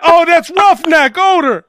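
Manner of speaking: loud, high mock-bully jeer; taunting bully. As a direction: holler it down the hall to tease him. Acting out bullies taunting Odor.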